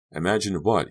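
ordinary delivery

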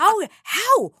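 high, loud, disbelieving cry; exasperated yell. Retold or imitated. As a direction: fling it out at the broken internet. Disbelief at internet failing.

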